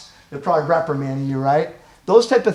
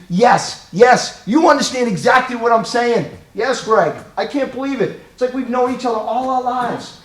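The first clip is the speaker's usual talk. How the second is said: higher-pitched, emphatic validation; acted eager agreement; excited agreement; 6 semitones higher, 4 dB louder than usual. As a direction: exclaim it like you finally feel understood. Acted relief at being understood.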